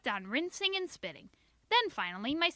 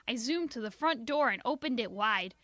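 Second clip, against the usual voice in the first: usual speaking voice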